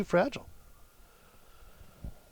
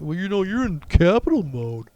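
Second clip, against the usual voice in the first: snide know-it-all voice, a bit louder; mock Windows popup; 4 semitones higher, 4 dB louder than usual. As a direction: parody a nagging computer. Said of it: "I need Windows telling me".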